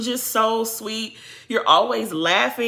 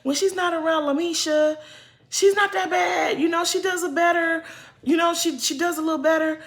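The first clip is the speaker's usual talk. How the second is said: higher-pitched quote of your own defence; higher, lightly acted praise; retold praise; 7 semitones higher than usual. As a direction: speak up for her in a raised pitch. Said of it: "I was praising Irish at first".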